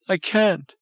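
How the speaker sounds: high, breaking woman's voice; tearful refusal. Acted. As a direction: refuse through sobs. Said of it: "Crying like a child".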